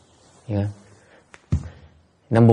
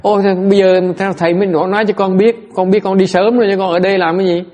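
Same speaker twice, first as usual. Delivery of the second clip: eager disciple; 9 semitones higher, 6 dB louder, faster than usual. Retold or imitated. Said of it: "đệ tử tôi nó nói".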